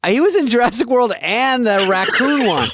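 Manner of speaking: exasperated reply. Retold or imitated. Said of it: "I go".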